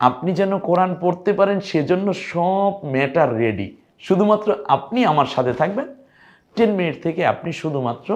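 excited encouragement. Real, not acted. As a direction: lift the students up with a bright call. Teacher rallying students.

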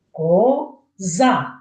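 КОЗА as ко... за, two long beats; each syllable of коза held and set apart; split into syllables. Shown as a demonstration. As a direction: sound out коза slowly by syllable. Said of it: "стільки і складів в цьому слові".

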